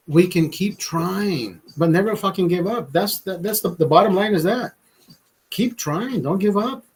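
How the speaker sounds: ordinary delivery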